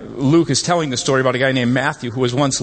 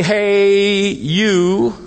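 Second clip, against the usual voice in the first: a higher, louder hail across the room; calling out; 8 semitones higher, 4 dB louder than usual. Shown as a demonstration. Preacher looking around the crowd, calling out. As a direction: call out to catch attention. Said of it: "I can say".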